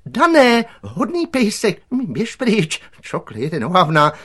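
very high, loud, strained babble; panicky director. A narrator's reading. Director clinging to pole tries soothing the dog, panicking. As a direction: coax the dog, then shoo it in panic.

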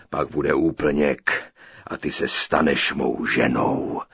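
low, slow giant with booming swells; gloating giant. A narrator's reading. Giant threatens his captive with marriage, slow gloating boom. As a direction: drawl it hugely, low and menacing.

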